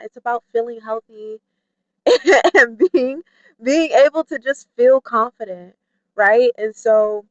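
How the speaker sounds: ordinary delivery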